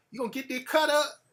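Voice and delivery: lightly voiced country girlfriend; country girl voice. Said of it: "She would talk that country fucking shit".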